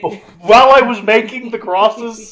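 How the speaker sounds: loud, high-pitched mock complaint; exasperated punchline. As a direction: deliver it as an indignant, hammed-up punchline. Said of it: "I love that gets a punchline".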